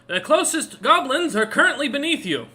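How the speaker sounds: high, loud guard shouting to his liege; full-voiced military announcement; shouted report. A narrator's reading. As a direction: call it out across a throne room. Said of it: "He shouts at the top of his lungs".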